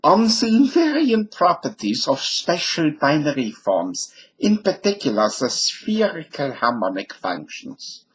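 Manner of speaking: mock German accent. Shown as a demonstration. Lecturer jokingly reads the translated title as if German, mock German accent. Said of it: "Now I'll read that in German".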